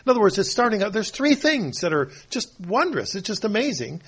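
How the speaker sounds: ordinary talk, nothing marked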